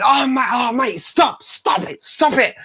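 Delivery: high-pitched mock begging to stop; laughing protest. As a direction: imitate a friend protesting through laughter. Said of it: "laughing, laughing, going".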